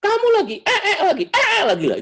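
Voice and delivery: put-on high, loud shrill-free scolding of a baby; mother shrieking at a baby. Mother yelling at her baby, high and loud. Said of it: "ngomongnya sama bayi aja".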